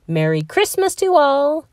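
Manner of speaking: ringing out. A narrator's reading. Santa calling to the teachers from his flying bus, mildly ringing. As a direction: ring it out a little, jolly. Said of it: "his voice rang out with might".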